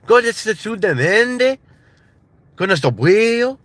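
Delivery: high, sing-song Spanish accent imitation; sing-songy accent. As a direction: lilt it way up in a mock accent. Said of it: "kinda sing-songy".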